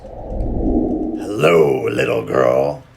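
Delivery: low growling wolf voice; deep growly wolf. Acted. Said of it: "said a deep, growly voice".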